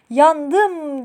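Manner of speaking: high, loud acted cry of pain; storyteller's loud, drawn-out yowl; pained shout. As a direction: yell out as if just bitten. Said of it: "diye bağırarak kendini bahçeye atmış".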